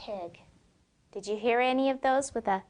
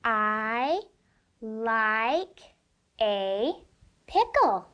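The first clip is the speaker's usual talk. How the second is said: slow word by word; much slower than usual. Shown as a demonstration. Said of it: "Let's read it kinda slow".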